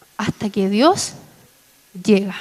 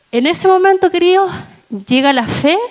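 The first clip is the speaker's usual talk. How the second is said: fervent exclamation; 6 semitones higher than usual. Real, not acted. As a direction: lift the voice high to the congregation. Preacher rises to climax. (exclamación fervorosa, mucho más aguda y fuerte)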